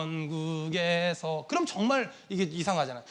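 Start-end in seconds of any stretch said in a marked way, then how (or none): 0.0-1.5 very low, softer, dragging slow; slow, low hymn-like drawl on 전국에서; drawn-out hymn line. Shown as a demonstration. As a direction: stretch 전국에서 out syllable by syllable, low and soft. Demonstrating how a hymn is sung.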